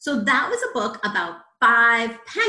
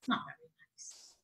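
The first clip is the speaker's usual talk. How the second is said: hushed, disapproving whisper; whispered aside; 13 dB quieter than usual. Real, not acted. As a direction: confide it quietly to small listeners. Aside to children about the frog.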